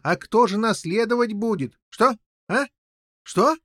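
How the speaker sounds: high-pitched agitated king; very excited. A narrator's reading. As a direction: spill out the news in great agitation. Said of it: "чрезвычайно возбудившись, закричал король Пеленор". (крайне возбуждённо)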